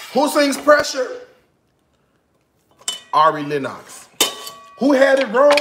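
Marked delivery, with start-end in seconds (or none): 0.1-1.2 higher, louder question; gloating. Real, not acted. Teasing boyfriend over who sings it. 4.8-5.5 much higher, louder taunt; gloating. Real, not acted. Teasing boyfriend who had it wrong.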